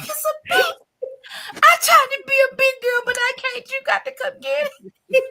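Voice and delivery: high, squealing plea for rescue; hammed-up scared little voice; panicked plea. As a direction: wail it helplessly to your brother. Retold panicked call to brother.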